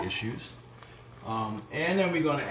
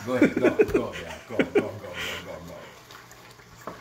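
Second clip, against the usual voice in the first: laughing urging; 3 semitones lower, 4 dB louder than usual. Real, not acted.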